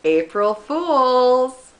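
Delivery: loud, high, very drawn-out taunt; gleeful prankster. Retold or imitated. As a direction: sing out the prank reveal, stretched slowly. Friend reveals the prank gleefully.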